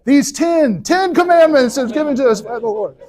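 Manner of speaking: flustered grand proclamation, covering the mistake; Mel Brooks Moses. Retold or imitated. Moses fumbles after dropping a tablet, hammed-up high proclamation. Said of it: "he goes".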